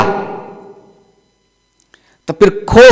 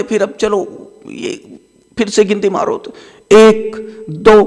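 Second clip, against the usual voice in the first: ordinary delivery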